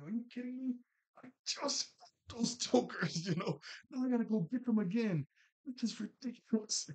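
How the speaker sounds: high, exasperated rant with the first words slowed; fed-up hero whining about fighting the same ghosts; exasperated Danny. Retold or imitated. Danny complaining he must recapture the escaped ghosts, high and exasperated. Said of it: "And Danny like".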